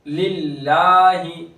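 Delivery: slow chant of लिल्लाहि with a light, thin लाम; thin lam recitation. Shown as a demonstration. Teacher recites the word to show the thin lam. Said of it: "यहाँ पर बारीक होगा लाम".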